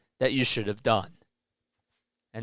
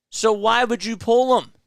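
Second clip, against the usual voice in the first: louder, higher, demanding why; angry outburst; 8 semitones higher, 5 dB louder than usual. Real, not acted. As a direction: fire the question at the manager. Angry fan ranting at manager.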